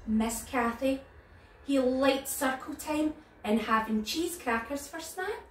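ordinary delivery, nothing marked

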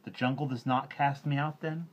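put-on boy blurting his shock; stammering Mowgli. A narrator's reading. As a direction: Mowgli asking his friends. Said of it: "Mowgli stammered".

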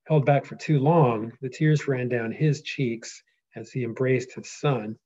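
measured storyteller reading; reading aloud. Acted. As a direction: let the reunion land slowly. Reads the epic aloud.

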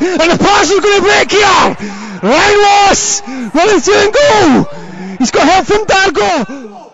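excited commentary. Real, not acted. Attack breaks, commentator rises.

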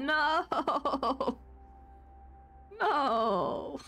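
normal talking voice

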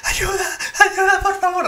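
high, sobbing plea; crying, agitated. Retold or imitated. Sandra on the phone to the operator, crying high. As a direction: imitate a panicked woman crying for help. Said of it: "así llorando bien agitada".

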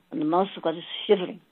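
normal talking voice

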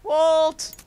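loud mock call across the jungle. Joking as a father searching for his missing son, loud call. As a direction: yell the name like a frantic father. Calling for the lost boy.

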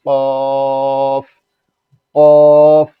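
কফ with the vowel held for four beats; loud, very long কফ; elongated vowel. Shown as a demonstration. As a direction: stretch each কফ loud on one steady note. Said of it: "চার আলিফ পরিমাণ টানতে হবে".

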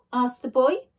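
ordinary delivery

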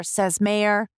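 ordinary delivery, nothing marked

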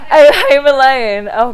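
loud, high laugh of getting it; laughing realization. Real, not acted. Realizing the Home Alone joke, laughing. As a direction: burst out laughing at the pun.

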